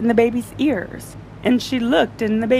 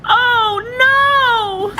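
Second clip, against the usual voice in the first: high loud shocked oh no; mother crying out in dismay; alarmed cry; 5 dB louder than usual. Acted. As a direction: yelp it in horror. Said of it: "yelled the mother".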